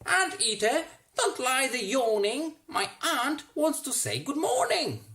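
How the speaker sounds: high, loud yelled call; yelling. A narrator's reading. Roy yelling at his anteater to greet aunt, high and loud. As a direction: call out across the garden at full voice. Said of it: "Roy yelled".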